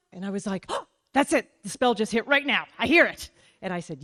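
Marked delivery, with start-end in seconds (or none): 0.7-3.3 higher and louder, thrilled it worked; excited squeal of triumph; giddy excitement. Retold or imitated. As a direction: gush like the spell just hit. Thinks the spell worked.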